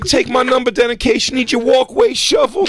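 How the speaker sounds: very high, loud cartoon squeal; squeaky wannabe-tough kid voice; mock street-kid hype man. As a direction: brag in a hammed-up falsetto shout. Both play cartoonish street-kid character.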